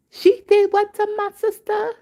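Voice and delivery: put-on very high, squealed outrage of a teenage girl; teen's shocked outrage. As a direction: shriek it like a stunned teenage girl. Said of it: "The teenage one".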